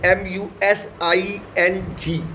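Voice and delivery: ordinary talk, nothing marked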